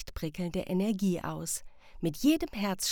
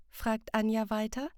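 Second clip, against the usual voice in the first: usual speaking voice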